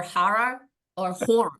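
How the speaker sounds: HORROR first as HAH-ruh, then as rounded HOR; regional variants. Shown as a demonstration. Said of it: "regional debate on how to pronounce horror".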